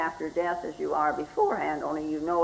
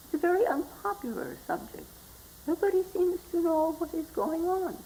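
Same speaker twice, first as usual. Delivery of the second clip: soft, much higher confiding voice; hushed secrecy; 10 semitones higher, 5 dB quieter than usual. Acted. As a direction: mimic embarrassed, hush-hush talk in a high, softened voice. Mimics hushed talk about death.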